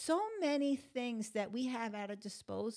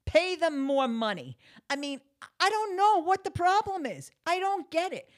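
exasperated; 7 semitones higher, 8 dB louder than usual. Real, not acted. Ranting about rising costs.

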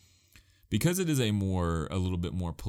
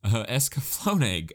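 ordinary talk, nothing marked

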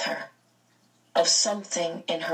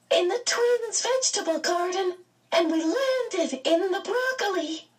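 put-on very high little boy voice; a delighted child, amused; high giggly child; laughing child; 13 semitones higher than usual. A narrator's reading. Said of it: "suddenly he laughed".

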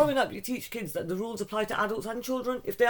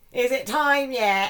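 put-on high, slow little-girl voice, a bit louder; little girl's voice; 6 semitones higher, 7 dB louder, much slower than usual. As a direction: ask it like a small child waiting on the naughty step. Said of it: "all I can hear is a little voice go".

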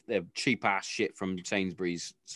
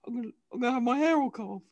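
high, quick imitation of a crestfallen child; upset little daughter; 12 semitones higher, faster than usual. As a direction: mimic a small girl near tears. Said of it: "little face just dropped. Like, she was like".

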